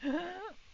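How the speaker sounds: sudden audible intake of breath; mock gasp. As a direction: gasp as if spotting danger. Imitates reaction to nuke warning.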